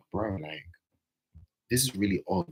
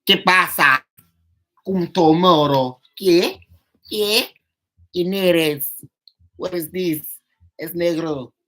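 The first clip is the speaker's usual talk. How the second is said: loud, high, hammed-up Spanish rant; mock Spanish outburst; 10 semitones higher, 10 dB louder, slower than usual. Retelling her reply to a cheeky message, mock Spanish yelling. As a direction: blast it out like a shocked, angry Spanish auntie. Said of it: "I said".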